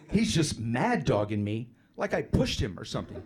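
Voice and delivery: normal talking voice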